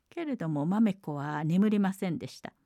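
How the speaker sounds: ordinary delivery, nothing marked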